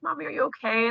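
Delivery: put-on high little-kid voice, gently asking; concerned child. Kids checking on their crying mom, concerned child voice. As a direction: imitate a worried small child. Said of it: "they're like".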